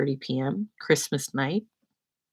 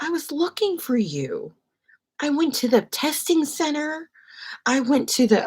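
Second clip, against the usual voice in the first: higher, lightly voiced chatty agent; a hint of a flustered agent; imitating agent; 10 semitones higher than usual. Voicing the airline agent.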